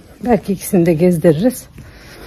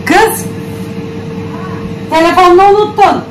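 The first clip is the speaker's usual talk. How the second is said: calling out; 10 semitones higher than usual. Real, not acted. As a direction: shout after her across the house. Calling after her leaving sister.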